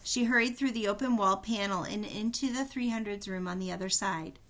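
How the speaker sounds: ordinary delivery, nothing marked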